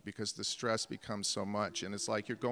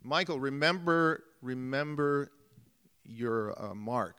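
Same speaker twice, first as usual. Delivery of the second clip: loud reminder; 4 semitones higher, 6 dB louder, slower than usual. Retold or imitated. Director reminding actor on set.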